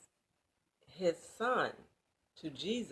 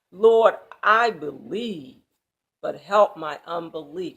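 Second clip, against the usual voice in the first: crying out; 10 dB louder than usual. Acted. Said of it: "cried out and said with tears".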